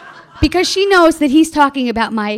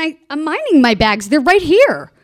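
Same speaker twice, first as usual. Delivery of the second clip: louder, higher, defensive comeback; indignant retort; 4 semitones higher, 7 dB louder than usual. Retold or imitated. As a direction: protest as if wrongly accused. Indignant reply to airport worker.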